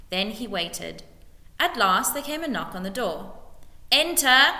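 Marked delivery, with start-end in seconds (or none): 3.9-4.5 loud, high call of Enter; shouted. A narrator's reading. Lord calling a visitor in, shouting. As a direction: bark the word through the door. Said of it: "shouted Spittleworth".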